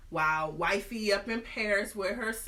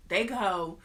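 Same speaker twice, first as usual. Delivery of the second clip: ordinary talk, nothing marked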